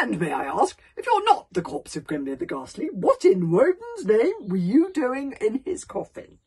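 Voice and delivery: loud, blustering demand; hearty. A narrator's reading. Stoic questioning the man from the coffin, hearty. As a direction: boom the question out with full chief's confidence. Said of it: "in much of his old hearty manner".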